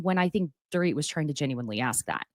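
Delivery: ordinary talk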